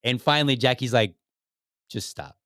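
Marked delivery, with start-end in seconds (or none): 1.9-2.4 quiet. Retold or imitated. Jackie cutting off awkward teammate.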